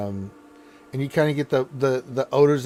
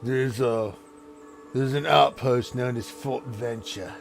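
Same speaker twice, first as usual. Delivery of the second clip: a hint of a troubled ogre chieftain asking for help; ogre lord. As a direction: ogre ruler, worried, asking the party for help.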